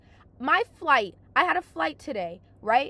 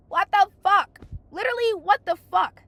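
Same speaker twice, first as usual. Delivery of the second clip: outraged; 6 semitones higher, 6 dB louder than usual. Real, not acted. Outraged rant about flight.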